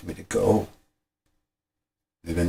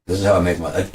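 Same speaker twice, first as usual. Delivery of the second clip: normal talking voice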